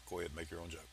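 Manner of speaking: low, quiet dare; muttered aside. Pretend. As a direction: mumble it like an aside. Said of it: "I'm waiting".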